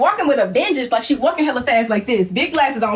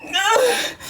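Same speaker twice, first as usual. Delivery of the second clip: mock sob groan; 11 semitones higher than usual. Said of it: "she's like".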